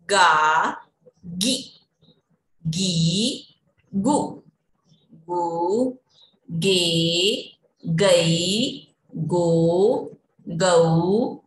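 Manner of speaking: slow paced teacher chant, each syllable set apart; syllable drill recitation. Shown as a demonstration. Teacher leading children through the क row, slow recitation. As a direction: recite the vowel chart for little kids.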